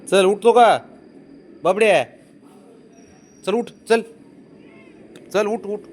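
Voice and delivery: high, loud cajoling calls; high urging. Real, not acted. Owner cajoling stubborn pet, high loud urging.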